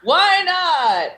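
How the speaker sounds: ordinary talk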